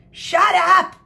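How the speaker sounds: high, loud furious scream. Acted. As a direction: bellow at the cheering kids.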